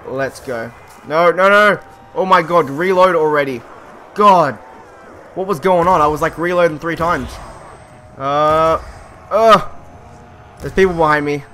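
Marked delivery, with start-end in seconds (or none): 1.1-7.3 loud, high exasperated yelling; frustrated shout. Real, not acted. Frustrated during zombie attack. 8.2-11.4 startled yell. Real, not acted. Zombies attack from behind.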